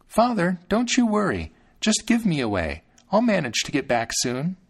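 ordinary delivery, nothing marked

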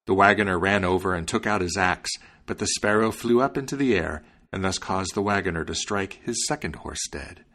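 ordinary delivery

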